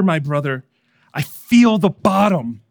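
loud, steadfast call to a friend; narrated reassurance, called out firmly. A narrator's reading. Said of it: "responds steadfastly".

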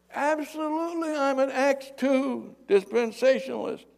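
high, loud call from above; acted distant shout; yelling down. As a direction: holler the answer down to the ground. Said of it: "the guy yells down".

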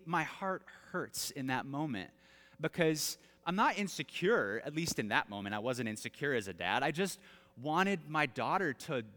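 ordinary talk

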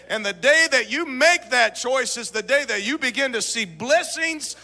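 loud, rousing preacher's call to the dads; revival-style sermon delivery; full-force preaching. Acted. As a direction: preach it out and lift the room.

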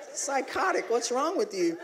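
high-pitched comic bewilderment; mock disbelief. Husband reacting to wife's hit, high mock disbelief.

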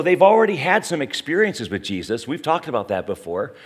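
ordinary talk

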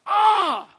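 sudden high shouted "Ah!"; a loud, high, strangled yell of outrage; outraged cry. Retold or imitated. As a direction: let out a shocked, furious cry. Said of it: "They're like".

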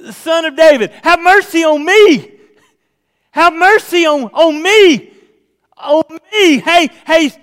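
high, loud, desperate-sounding shout; crying out. Shown as a demonstration. Preacher shows his new reading as the blind beggar, crying out. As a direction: shout it, stretching and punching "me". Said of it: "I started reading it a little different".

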